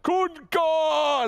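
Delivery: high, loud shouted name; Tidehunter scream. Retold or imitated. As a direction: bellow the name like a furious sea giant. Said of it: "if I had the voice, the voice Tidehunter?".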